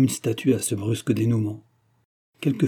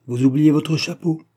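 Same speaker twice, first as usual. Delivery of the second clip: raised, cool disdain; louder, higher, dismissive reminder; calmly disdainful; 6 semitones higher, 6 dB louder than usual. A narrator's reading. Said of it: "d'un ton tranquillement dédaigneux".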